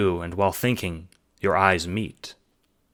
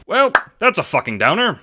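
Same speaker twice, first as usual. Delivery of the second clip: much higher, loud exclaim; loud announcement; 13 semitones higher, 9 dB louder than usual. Acted. Jacob breaking the silence, loud and brash. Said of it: "Jacob announces".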